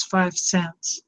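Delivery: a light, soft hint of bossy little Lucy; Lucy in her booth. Retelling her childhood, a lightly voiced Lucy asking for five cents. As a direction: toss off Lucy's price line in a small voice. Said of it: "the original Lucy who sits in her little booth".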